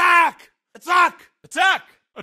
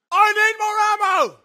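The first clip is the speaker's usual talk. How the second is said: ordinary delivery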